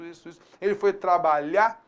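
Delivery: "trabalhar" said as "trabalhá", with the final R cut; casual speech, "trabalhar" without its R; colloquial, dropped R. Shown as a demonstration. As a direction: say "ele foi trabalhar" the way people speak, swallowing the final R. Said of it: "como a pessoa fala".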